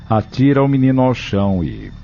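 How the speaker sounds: normal talking voice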